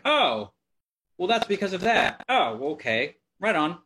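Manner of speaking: light up as the penny drops; sudden realization. Retold or imitated. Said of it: "And then it's like".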